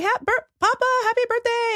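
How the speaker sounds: high, bright happy birthday call; grandkid greeting. Retold or imitated. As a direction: call out cheerfully. Grandkid voiced in retold video.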